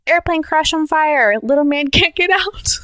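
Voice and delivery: put-on a toddler shrieking about a burning plane; child screaming.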